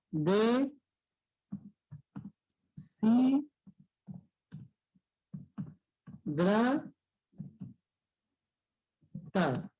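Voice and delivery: "De, si, dra, tar" each syllable alone with long pauses. Shown as a demonstration. Teacher dividing deshidratar for the class, one syllable at a time. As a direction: sound out deshidratar slowly. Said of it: "deshidratar sería de esta manera".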